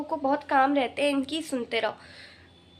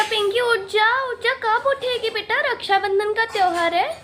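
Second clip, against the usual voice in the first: calling to wake up; 8 semitones higher, 3 dB louder than usual. Acted. Mother waking daughter again.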